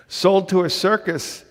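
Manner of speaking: ordinary delivery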